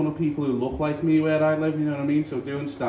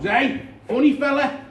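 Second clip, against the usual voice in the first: taxi driver; 12 semitones higher, 5 dB louder than usual. Retold or imitated. Taxi driver leaning out to call at the comedian, loud high shout. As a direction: lean out and holler like a cabbie. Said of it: "taxi driver leans out the car and goes".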